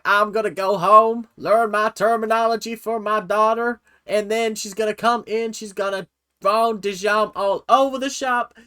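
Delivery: hammed-up Southern queen voice; Southern accent. Imagining the mom learning dance terms, mock Southern accent. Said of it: "doing the Southern accent".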